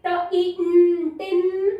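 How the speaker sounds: ट-, ई-, न-, टिन sound by sound, then blended; children sounding out. Retold or imitated. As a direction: imitate kindergartners spelling out टिन by sounds. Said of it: "पढ़ते हैं". (बच्चों जैसे ट-, ई-, न-, टिन जोड़कर पढ़ना)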